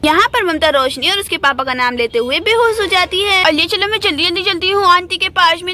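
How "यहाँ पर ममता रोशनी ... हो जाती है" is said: story narration. A narrator's reading.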